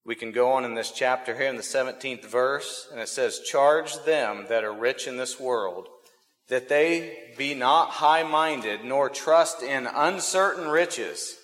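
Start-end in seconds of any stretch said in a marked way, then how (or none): 3.4-11.4 slow, solemn Bible reading; scripture reading. Acted. Preacher reading the seventeenth verse to the congregation, solemn. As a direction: read it out slowly like holy text.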